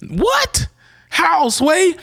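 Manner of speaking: very high-pitched mock disbelief; flabbergasted squeal. Hiker hearing they will walk up there, squeaky flabbergasted. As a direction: squeak it out, utterly baffled. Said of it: "That's how flabbergasted I was".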